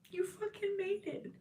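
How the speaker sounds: high, soft voice breaking into tears; choked up, fighting back tears; crying. Real, not acted. Tarot reader to her viewers, voice cracking with tears. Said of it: "Sorry, I don't know why I'm crying".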